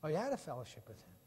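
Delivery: usual speaking voice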